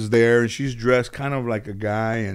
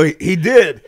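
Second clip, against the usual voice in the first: loud reply through laughter; giggling yes; laughing reply; 7 semitones higher, 7 dB louder than usual. Real, not acted. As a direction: laugh as you confirm it. Confirming while laughing.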